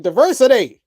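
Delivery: high, loud mock proclamation; mocking imitation. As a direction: proclaim it in a mimicking voice. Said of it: "He's like".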